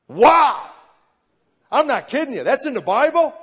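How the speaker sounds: high, loud astonished exclamation; amazed outburst. Retold or imitated. Preacher reliving his amazement at the Bible, astonished outburst. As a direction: burst out in reenacted disbelief.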